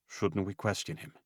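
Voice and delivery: soft, quiet suggestion; quietly. Acted. Ben quietly urging Luke to question him. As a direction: gently suggest it. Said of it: "he said quietly".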